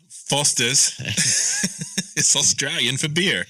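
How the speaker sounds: mock Aussie ad line, falling on "beer"; a playful Australian slogan; Australian accent. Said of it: "talk the whole time with this accent".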